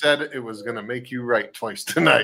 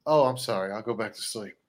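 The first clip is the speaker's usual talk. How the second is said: soft, sinking bedtime apology; hushed and defeated retreat; acted meek apology; 4 semitones lower, 8 dB quieter than usual. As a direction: back down quietly, fading away. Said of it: "after you've already fallen asleep".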